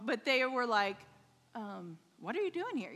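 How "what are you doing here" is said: higher-pitched, mock student questioning. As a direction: lightly act out puzzled students. Puzzled students questioning a stranger.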